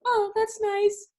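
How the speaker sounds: very high, drawn-out fake niceness; mock polite cover-up. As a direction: coo it slowly, much too sweetly. Acts her hiding upset from prince.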